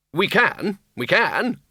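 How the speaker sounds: high, curt insistence; snapped. A narrator's reading. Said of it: "snapped Ridcully".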